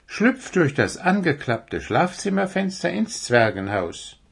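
high deer voice giving instructions; young deer character. Acted.

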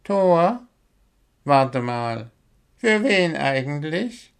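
slow-witted sleepy owl, puzzled; Willi, just woken. A narrator's reading. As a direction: ask in a high, drowsy voice. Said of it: "Willi war gerade erst wieder wach geworden".

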